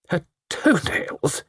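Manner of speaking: a shiver of nausea in the voice; disgusted shiver. A narrator's reading. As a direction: shudder over the words. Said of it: "with a shiver of nausea".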